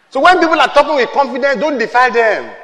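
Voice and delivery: ordinary talk, nothing marked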